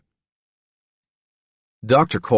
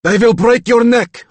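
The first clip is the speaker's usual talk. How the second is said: high, loud furious roar; 8 dB louder than usual. Acted. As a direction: roar a threat. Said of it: "Reagan roared".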